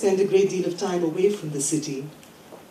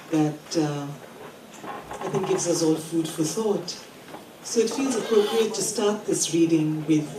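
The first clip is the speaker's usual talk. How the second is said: ordinary talk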